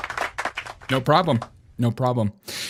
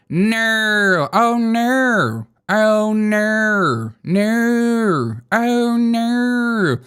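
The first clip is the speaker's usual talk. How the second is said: high, drawn-out Aussie "oh no"; imitating an Australian wailing "oh no"; stretched, sing-song Australian "oh no" in a higher voice; mock Australian no; 7 semitones higher, much slower than usual. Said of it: "Why do you guys say it like that?".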